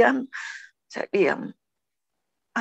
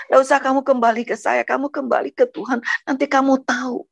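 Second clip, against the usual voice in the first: higher-pitched, insistent retold plea; retelling a firm admonition; firm retold urging; 7 semitones higher than usual. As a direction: urge him earnestly, pitched up. Retelling her firm plea to husband.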